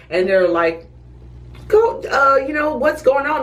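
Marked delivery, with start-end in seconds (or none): 1.7-3.4 higher, curious questioning voice; prying paramedics. Retold or imitated. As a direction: push for the gossip, mimicking them.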